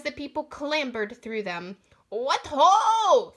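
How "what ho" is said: loud hail; calling out. Acted. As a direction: hail them heartily. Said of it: "Fiduciary called out".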